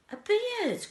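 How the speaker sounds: very high, loud disgusted cry; bratty girl's outcry. A narrator's reading. Veruca Salt crying out at the beard idea, shrill loud. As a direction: shriek it like a spoiled girl. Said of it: "cried Veruca Salt".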